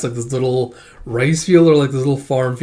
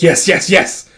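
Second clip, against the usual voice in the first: loud, raised-pitch triumphant cries; excited cheering; 5 semitones higher, 5 dB louder, slower than usual. Acted. A fighter celebrates a hit, cheering excitedly. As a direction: cheer each word in triumph.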